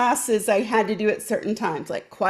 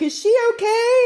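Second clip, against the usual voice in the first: high, louder mock concern; worried driver; 12 semitones higher, 4 dB louder than usual. Passing driver asking about the girl, lightly voiced worry. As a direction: call out from a passing car. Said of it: "cars would pull over like".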